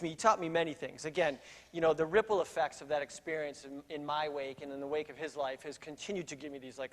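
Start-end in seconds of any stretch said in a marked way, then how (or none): none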